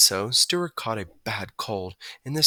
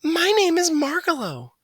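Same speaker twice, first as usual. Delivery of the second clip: a high, lilting bird voice; sing-song and sweet; soft musical voice; 4 dB louder than usual. A narrator's reading. Margalo introducing herself to Stuart, high and musical. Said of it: "said the bird softly in a musical voice".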